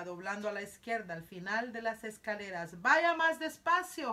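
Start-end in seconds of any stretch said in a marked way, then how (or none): 2.8-4.1 loud, high and slowed, mock winded; panting. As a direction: plead for a slower pace, lightly acting out of breath. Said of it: "jadeó la señora Sal". (jadeando a medias, fuerte y lenta)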